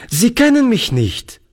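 put-on a boy bursting to be heard; lively boy. A narrator's reading. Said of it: "sagte Toni lebhaft".

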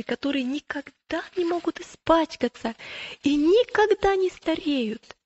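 put-on high, enthusiastic young pilgrim's voice. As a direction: voice an eager believer describing a wonderful land.

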